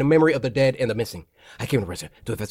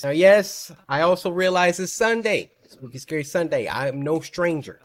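normal talking voice

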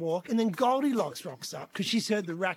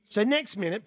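normal talking voice

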